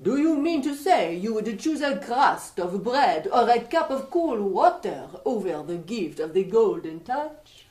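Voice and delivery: put-on light French accent, playful stranger; a mischievous Frenchman teasing the king. French young man.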